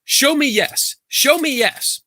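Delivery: high-pitched mock incantation; high, insistent retold command; imitated dowser's command. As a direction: imitate a woman commanding her rods. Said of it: "She's like".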